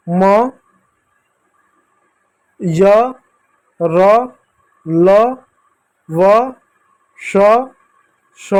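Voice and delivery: each letter alone with a rising tune and a pause; sing-out alphabet drill, every letter lilting upward; reciting the consonants. Shown as a demonstration. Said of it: "इस रूप में इन सभी का उच्चारण होता है". (हर व्यंजन अलग-अलग, चढ़ते सुर में)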